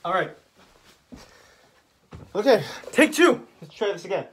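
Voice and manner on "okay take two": loud, high restart call; excited. Real, not acted. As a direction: call out take two.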